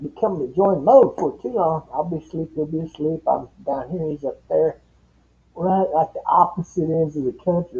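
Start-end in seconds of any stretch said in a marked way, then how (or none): none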